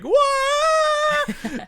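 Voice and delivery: very high, drawn-out shriek; loud high-pitched panicked scream; mock scream. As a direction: scream it out in comic terror. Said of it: "he's screaming because he sees a little".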